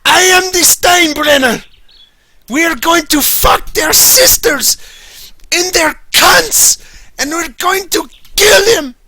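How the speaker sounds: high, loud, hammed-up Steinbrenner rant; shrieking mock Steinbrenner war cry. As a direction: bellow it like a deranged team owner. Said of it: "He's like".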